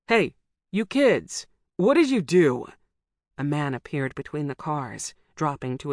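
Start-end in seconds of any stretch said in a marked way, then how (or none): 0.1-2.7 put-on a man yelling at fleeing kids; stranger calling out. A narrator's reading.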